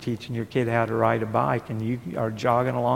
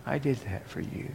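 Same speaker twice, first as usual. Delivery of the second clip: hushed voice of God; quiet God voice; 8 dB quieter than usual. Acted. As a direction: say it softly as God letting go. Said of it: "God said".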